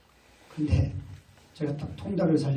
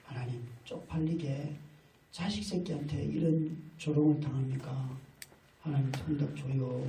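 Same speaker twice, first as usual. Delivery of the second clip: desperate quiet prayer; 2 semitones lower, 9 dB quieter than usual. Retold or imitated. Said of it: "그러고는".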